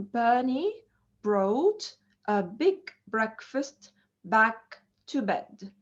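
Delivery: slow model reading with pauses between word groups; tongue twister, broken up. Shown as a demonstration. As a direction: lay out each b word separately for learners. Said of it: "like a tongue twister".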